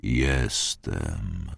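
like a far-off great bell; a deep otherworldly voice answers, low and muffled. A narrator's reading. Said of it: "Był niski, przytłumiony".

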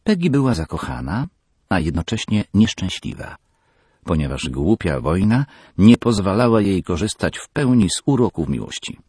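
ordinary delivery, nothing marked

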